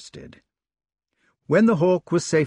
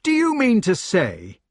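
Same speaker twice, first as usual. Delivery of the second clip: incredulous question; 10 semitones higher, 3 dB louder than usual. Acted. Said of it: "he asked".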